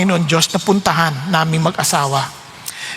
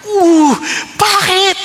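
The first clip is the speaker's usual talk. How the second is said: jolted, almost crying out; shocked; 10 semitones higher, much slower than usual. Retold or imitated. Hearing his wife refuse, shocked and high.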